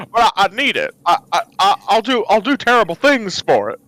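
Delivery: stammering, desperate addict in character; Jimmy Stewart. Acted. Jimmy confessing a McRib addiction, stammering desperately. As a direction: stutter through a hammed-up confession. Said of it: "No, Jimmy".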